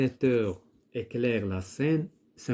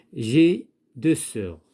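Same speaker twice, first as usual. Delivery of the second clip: emphatic reading; 8 dB louder, slower than usual. Shown as a demonstration. Teacher reads the answer sentence.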